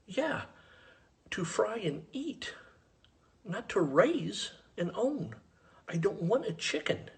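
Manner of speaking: high-pitched exasperation, spelled out slowly; exasperated retort. Retold or imitated. Retorts to the chicken trader.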